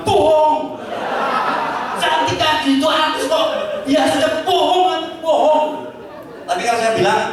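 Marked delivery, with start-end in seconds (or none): 0.1-5.9 high, teasing wife calling out a lie; hammed-up jealous wife, pitched high; mock accusing wife. Wife catches husband lying about pretty woman, high and accusing.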